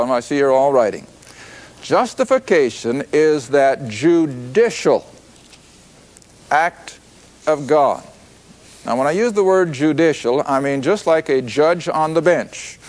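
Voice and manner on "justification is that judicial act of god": slow dictation. Shown as a demonstration. Preacher dictating a definition to note-taking listeners, slowly. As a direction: spell it out slowly for note-takers. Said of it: "I'm gonna say it slow".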